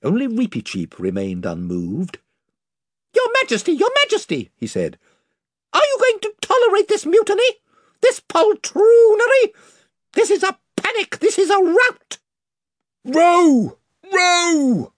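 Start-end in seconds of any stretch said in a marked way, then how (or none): 3.1-12.2 high, indignant squeak of a voice; a tiny noble mouse scolding cowards; proud mouse knight. A narrator's reading. Reepicheep the mouse protests the retreat to his king, high and indignant. 13.0-14.9 high, loud command; bellowing. A narrator's reading. Caspian orders his rowers to flee, bellowing high and loud. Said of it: "bellowed Caspian".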